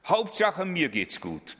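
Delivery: high, self-satisfied ego voice; smug egoist. Pretend. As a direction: crow it like a vain egoist. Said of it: "es steht ständig in dieser Welt". (hoher, selbstgefälliger Egoisten-Ton)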